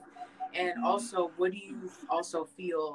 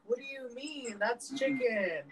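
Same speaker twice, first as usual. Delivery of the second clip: higher, drawn-out mock-innocent protest; puzzled kid protesting; 5 semitones higher than usual. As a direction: argue back like a baffled child. Confused child arguing back, retold.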